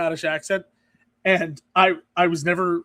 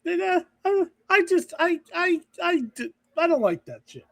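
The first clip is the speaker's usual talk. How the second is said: squeaky, higher protest, half-joking; mock high protest; 10 semitones higher than usual. Joking they love everybody, in a high mock-defensive squeak. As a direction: protest too much in a high voice.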